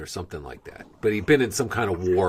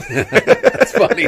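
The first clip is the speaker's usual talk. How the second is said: laughing; 9 dB louder, much slower than usual. Real, not acted. Laughing at the story.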